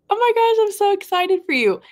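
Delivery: high, loud burst of excitement; excited. Real, not acted. Streamer cheering a viewer's finished book, excited.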